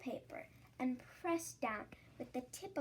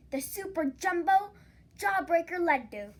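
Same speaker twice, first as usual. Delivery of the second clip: louder, higher, proclaiming it like a marvel; grand announcement; 4 semitones higher, 12 dB louder than usual. A narrator's reading. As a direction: announce the treat like a showman. Grand announcement of new sweet.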